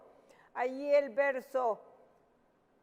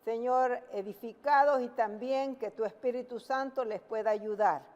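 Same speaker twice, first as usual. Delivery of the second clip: prayer; 4 semitones lower than usual. Real, not acted. Preacher closing a prayer before the sermon, hushed prayer.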